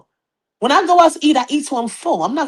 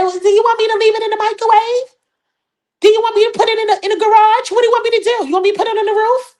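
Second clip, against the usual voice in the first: high-pitched mock-confused questions; 10 semitones higher, much faster than usual. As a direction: imitate her bewilderment in a high voice. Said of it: "You was so confused. You said".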